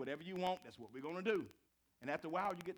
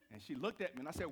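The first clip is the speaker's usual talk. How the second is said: ordinary talk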